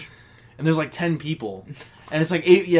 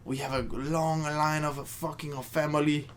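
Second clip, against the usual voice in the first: hushed mock Italian accent; bad Italian accent; 8 dB quieter than usual. Quoting the movie's grandpa in a bad Italian accent. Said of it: "I can do a bad Italian accent".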